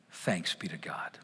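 whisper. Real, not acted. Closing words whispered.

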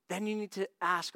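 usual speaking voice

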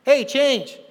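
mock street yell. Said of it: "stand on a ledge and yell at people".

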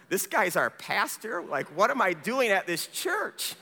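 imitating bewildered churchgoers; lightly acted questioning, swooping up and down; mock disbelief. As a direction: voice their incredulous second thoughts, playfully. Mimics doubtful congregation members.

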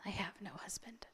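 breathy near-whisper, close to tears; barely voiced, shamed and hushed; almost a whisper. A narrator's reading. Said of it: "and almost a whisper".

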